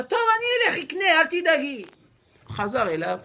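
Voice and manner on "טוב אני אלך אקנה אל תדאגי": much higher, louder reassurance; calm the wife with a forced high voice; placating. Acted. Husband placates worried wife.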